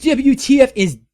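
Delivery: high, loud mocking jab; hammed-up cruel taunt; sadistic. A narrator's reading. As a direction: sneer the question, relishing it. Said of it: "I asked sadistically".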